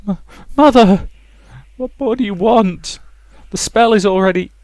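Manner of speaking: put-on high, frightened girl's cry; a cornered daughter pleading in terror. Rosa cowering from her raging mother, frightened high cry.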